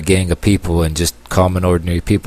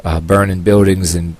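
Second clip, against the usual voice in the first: sarcastic. Retold or imitated. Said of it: "Yeah, I'm being sarcastic here".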